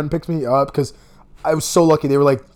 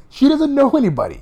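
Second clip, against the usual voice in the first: squeaky put-on voice, much higher; high mock voice; 11 semitones higher, 3 dB louder than usual. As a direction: mimic her in a high whiny tone. Mocking girl who knows nobody.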